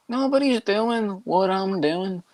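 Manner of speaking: put-on Irish lilt; Ian Gary. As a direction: draw it out in mock Irish. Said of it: "Ian Gary or something".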